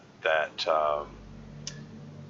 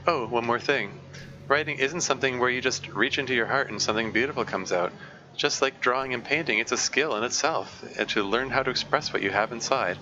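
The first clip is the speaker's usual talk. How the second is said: brisk read-aloud voice, a bit higher; 4 semitones higher than usual. Acted. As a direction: read the comic's advice out to the chat. Streamer reads comic's advice text aloud.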